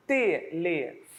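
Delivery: slow, evenly separated syllables; "telefone" broken into te, le, fo, ne. Shown as a demonstration. Teacher counting syllables aloud, slowly split.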